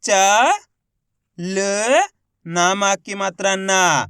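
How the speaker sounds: च and ल held apart, then a long ना; slow spelling, final ना stretched; letter by letter. Shown as a demonstration. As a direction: sound out each letter with pauses. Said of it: "एक ही अक्षर का उच्चारण करके".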